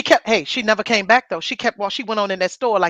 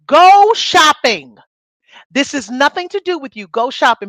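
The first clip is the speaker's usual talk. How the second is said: firm telling off; 4 semitones higher, 4 dB louder than usual. Retold or imitated. Telling off the nosy woman.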